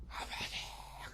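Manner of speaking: soft, breath-only drawn-out call; imitated prayer cry. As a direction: imitate crying out with barely any voice. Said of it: "아버지만 외쳤는데". (숨소리로 길게 부르는 외침 흉내)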